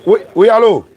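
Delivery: high loud hello into the phone; loud phone call. Real, not acted. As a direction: call out over a bad line. Appel téléphonique, ligne difficile. (allô crié au téléphone)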